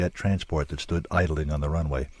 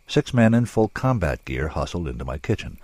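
plain reading voice

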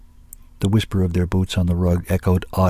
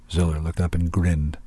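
ordinary delivery, nothing marked

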